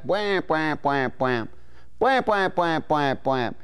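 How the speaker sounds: quacking wham and bwamp notes; duck call honks. Shown as a demonstration. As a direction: honk it like a string of quacks. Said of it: "You start out with a nice long quack".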